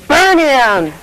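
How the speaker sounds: high, loud furious order; angry. Acted. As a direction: bark the death sentence. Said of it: "even more angry than ever".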